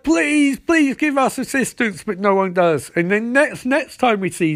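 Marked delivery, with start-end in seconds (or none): 0.0-2.0 high-pitched hammy begging; mock pleading. Crash victim begging for help, mock desperate.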